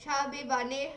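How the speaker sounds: talking through stuffed cheeks; mouth full. Real, not acted. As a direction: say it with a mouthful of marshmallows. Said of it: "You look like a chipmunk".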